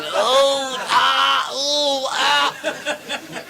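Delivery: rhythmic wailed oohs and aahs; mock moaning. Imitated moans from toilet cubicle.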